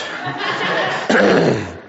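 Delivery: a real dry cough. Real, not acted. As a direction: cough to clear a creaky throat. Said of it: "Sorry, my creaky voice".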